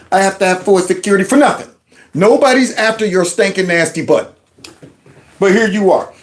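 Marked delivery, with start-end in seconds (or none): none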